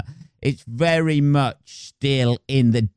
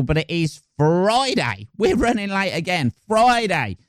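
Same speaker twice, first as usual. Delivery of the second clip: normal talking voice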